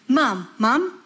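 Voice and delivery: put-on insistent kid's repeated calls; nagging child. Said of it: "when your child is saying".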